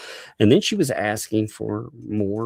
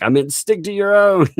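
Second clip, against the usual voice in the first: mock viewer voice; 10 semitones higher, 6 dB louder than usual. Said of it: "you were like".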